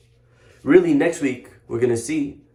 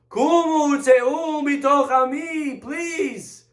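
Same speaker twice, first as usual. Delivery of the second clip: pleading mock scream, stretched at the top of the voice; imitated screaming plea; 12 semitones higher than usual. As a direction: wail it like a panicked ruler begging them gone. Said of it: "And he starts screaming".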